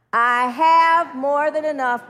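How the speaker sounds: slow, loud, emphatic declaration; forceful drawn-out affirmation; leading an affirmation. Shown as a demonstration. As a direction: proclaim it slowly, stretching "have".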